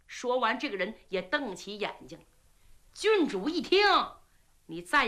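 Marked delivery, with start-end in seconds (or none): none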